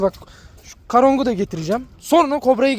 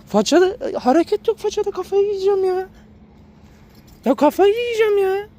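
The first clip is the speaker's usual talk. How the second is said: high exasperated whine; 11 semitones higher than usual. Real, not acted. Frustrated at motionless dog.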